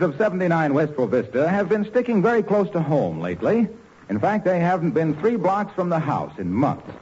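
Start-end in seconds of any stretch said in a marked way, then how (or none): none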